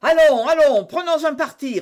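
loud, high, urging voice; impatient call. A narrator's reading. Jussac demands a decision from the musketeers, calling impatiently. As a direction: press them hard to make up their minds.

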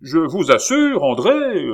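the baron working himself up; growing heated. A narrator's reading. Father lecturing André, getting heated. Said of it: "s'échauffant à mesure qu'il parlait".

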